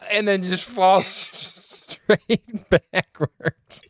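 high-pitched words breaking into laughter; can barely finish for laughing, slow and high; laughing hard. Real, not acted. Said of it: "I'm laughing so hard right now".

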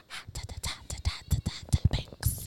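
rapid whispered hots, then stretch out topics; whispered catchphrase. Pretend. Co-host telling listeners how they like to start, playful whisper. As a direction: whisper it like a secret segment jingle.

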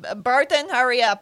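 loud urging. Acted. Player's character urging the ogre on, loud and urgent. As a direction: hurry him up.

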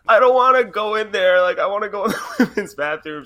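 put-on high, loud, fast kid whine; whiny little kid. Little boy whining to his mom outside the stall. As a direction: play the kid refusing to go. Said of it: "this little kid is like".

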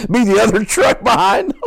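high and loud through laughter; laughing at his own prank idea. Real, not acted. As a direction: crack up mid-line. Joke continuing the mock shout.

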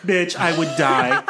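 loud, real outburst of amusement; amused outburst. Real, not acted. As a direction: blurt it out loudly, cracking up. Cracking up at friend's joke.

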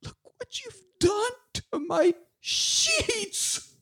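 mock opening line. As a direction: squeak it out slowly, way up high, mocking the line. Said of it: "we get our opening line".

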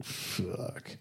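breathy whispered fuck, slow; muttered curse. Real, not acted. Reacting to the repair bill, with a whispered slow curse. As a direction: drop to a whispered, drawn-out curse.

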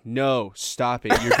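put-on loud, stern read with the girlish lilt gone; mock court transcript. Comedian's bit on a court transcript, mock-stern reading. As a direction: read it out like damning testimony, breaking up at the end. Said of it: "the way that reads out in a transcript in court".

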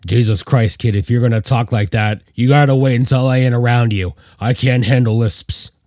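plain reading voice

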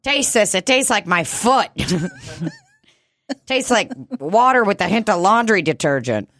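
hammed-up disgust, calling out from the kitchen; mock disgusted wife. Shopper calls her husband in to taste it, mock disgusted. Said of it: "continuing the shopper voice".

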